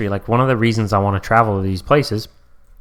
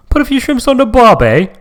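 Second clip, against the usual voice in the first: high, loud mock Australian accent; over-the-top Australian accent, shrimp on the barbie; 13 semitones higher, 8 dB louder than usual. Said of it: "That's a, that's a good Australian accent".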